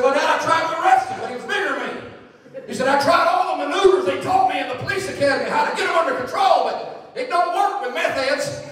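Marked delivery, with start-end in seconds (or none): 0.0-1.9 high, loud mock report to dad; excited son retelling. Son excitedly reporting arrest, retold. 2.9-8.7 high, loud mock report to dad; excited son retelling. Son excitedly reporting arrest, retold.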